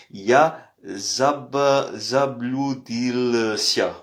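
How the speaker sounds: slow, syllable by syllable; slow demo. Shown as a demonstration. Teacher to French learners, slowly sounding out заблудился. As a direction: spell out заблудился slowly for students. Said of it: "Voilà, vous avez la prononciation".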